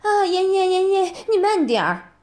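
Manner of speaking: lightly hammed-up impression of the ex; mocking his moaning. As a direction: parody his pleading moan. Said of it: "还嘴里还喊着".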